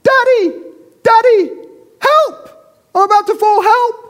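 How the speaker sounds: put-on high, loud, panicked child's cry; child crying for help. Daughter clinging to a tree branch calls her dad, panicked high cry. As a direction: scream for daddy as if slipping from a branch. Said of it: "she cries out".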